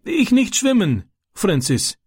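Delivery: high-pitched frightened begging; pleading. A narrator's reading. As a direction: implore him in short, desperate cries. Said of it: "flehte er und breitete bittend seine Hände aus".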